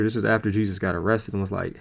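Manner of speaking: normal talking voice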